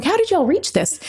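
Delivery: "What the hell?" jumps up high in mock shock; surprised. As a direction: react like you can't reach it. Surprise at periscope height.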